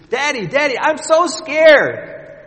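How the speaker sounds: put-on high, loud, slow frightened child voice; scared little girl. Trapped daughter calling down to her father, frightened. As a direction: plead like a terrified little girl. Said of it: "she was panicked".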